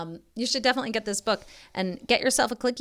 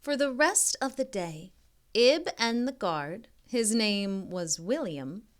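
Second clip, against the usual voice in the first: slow storybook reading voice; story narration; slower than usual. A narrator's reading. Reading a picture book aloud to young kids, gentle storybook narration. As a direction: read it like a bedtime picture book.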